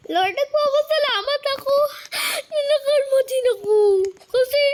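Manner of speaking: high, loud, fast half-crying thanks; overjoyed, laughing through tears; tearful joy. Real, not acted. Thanks Lord, near tears laughing.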